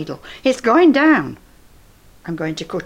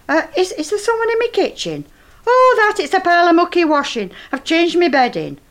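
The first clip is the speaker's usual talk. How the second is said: much higher, quick nervous excuse; flustered cover story; 9 semitones higher, 4 dB louder than usual. Acted. Old woman hastily lying to her neighbour about the doll. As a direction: bluff fast about the mucky washing.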